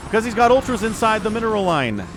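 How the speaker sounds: fast, loud live casting; play-by-play. Acted. Caster calling a StarCraft match live, excited. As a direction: call the game as it happens.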